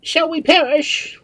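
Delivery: very high, loud, drawn-out question; shrill alarmed plea; fearful cry. A narrator's reading. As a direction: cry it out in dread. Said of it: "cried Marianne".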